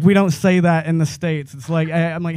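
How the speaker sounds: ordinary talk, nothing marked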